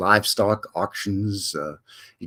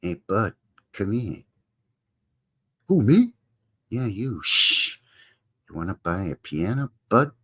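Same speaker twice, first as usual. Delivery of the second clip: low, softer back-alley hustler; a sketchy dealer pitching a stolen piano, hushed and low; shady hushed seller; 4 semitones lower, 4 dB quieter than usual. Acted. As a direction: lure the buyer in on the quiet. Shady piano thief skit.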